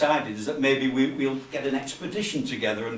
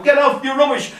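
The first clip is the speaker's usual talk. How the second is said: high, loud jeering heckle; heckler's shout; 10 semitones higher, 8 dB louder than usual. Acted. Imagined heckler shouts at a comic, jeering. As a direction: jeer it at the act on stage. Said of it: "the mirror's not even gonna shout".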